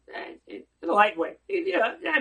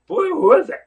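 loud challenge; 10 semitones higher, 13 dB louder than usual. Real, not acted. Rabbi challenging his listeners.

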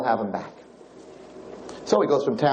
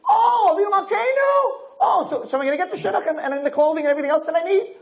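very high, hammed-up begging cry; mock wailing plea. People begging God for their needs, exaggerated high wailing. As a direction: wail the request high, parodying a petitioner.

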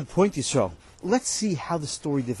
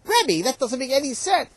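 high, loud protest; student protesting to the rebbe, high and loud; worked up; 10 semitones higher, 5 dB louder than usual. Real, not acted. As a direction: object heatedly. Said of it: "[name], [name], calm down".

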